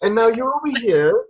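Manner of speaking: put-on high, sweet English accent, like an angel; angelic English accent. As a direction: play a gentle English angel directing people. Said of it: "an angel with an English accent saying".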